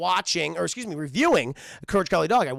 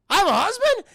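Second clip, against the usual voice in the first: high, louder bewildered granny voice; surprised old lady; 13 semitones higher, 7 dB louder than usual. Acted. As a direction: act baffled, squeak it out. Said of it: "She's like".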